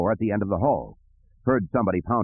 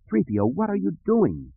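high-pitched, alarmed demand; 11 semitones higher than usual. Acted. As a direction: demand an explanation from the erring droid. Luke alarmed at droid's flying.

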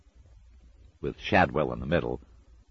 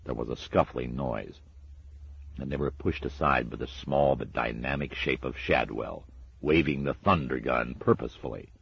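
plain reading voice

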